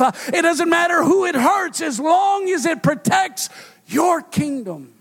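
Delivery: fiery sermon climax; preaching at full force. Acted. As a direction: preach it hard to the congregation.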